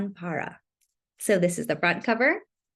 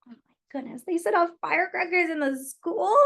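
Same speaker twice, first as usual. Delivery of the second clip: mock surprise; 8 semitones higher than usual. Reacting to story for kids.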